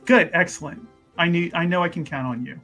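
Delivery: ordinary delivery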